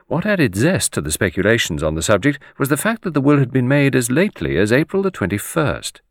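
ordinary delivery

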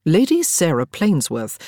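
ordinary delivery

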